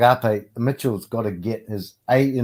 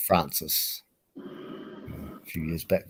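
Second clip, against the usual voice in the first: ordinary delivery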